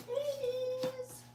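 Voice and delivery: high, soft coo. Real, not acted. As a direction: softly coo to the kittens. Calling kittens softly.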